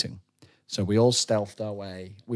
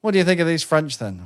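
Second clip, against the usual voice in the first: mock NPC chatter; 6 semitones higher, 3 dB louder than usual. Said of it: "N P C dialogue".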